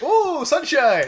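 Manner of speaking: high, slow drawn-out delight; mock excitement. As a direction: swoon over the sunshine. Mock excitement over sunshine.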